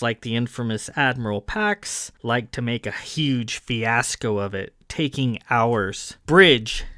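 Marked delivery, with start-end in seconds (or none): 6.3-6.8 sharp loud bark, pitched up; barked command. Acted. Captain barks the bridge to attention, loud. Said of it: "Davidge barked".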